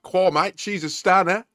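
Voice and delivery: high, loud mock Australian; exaggerated Australian exclamation; Australian accent. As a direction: put on a loud, high-pitched Aussie voice. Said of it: "'Cause it's Australian".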